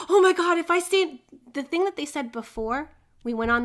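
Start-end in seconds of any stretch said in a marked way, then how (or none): 0.1-1.2 retold panic, higher and louder; freaking out. Rider spinning on lake ice, acted panic. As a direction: gasp it out as remembered alarm. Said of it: "I'm freaking out because I'm like".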